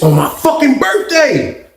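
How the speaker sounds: slow, rising hit on "fucking birthday"; emphatic punchline. Real, not acted. Punchline of his story.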